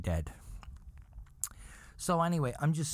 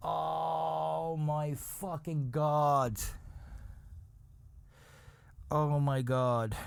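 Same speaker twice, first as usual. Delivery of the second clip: long, drawn-out words, slightly raised; horrified disbelief; 5 semitones higher, much slower than usual. Real, not acted. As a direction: let it out slowly, appalled. Horrified by the spider story.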